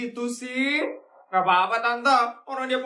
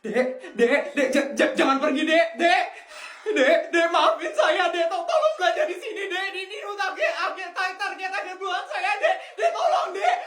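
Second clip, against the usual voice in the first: desperate pleading; 6 semitones higher than usual. Acted. Clerk begs kid not to leave.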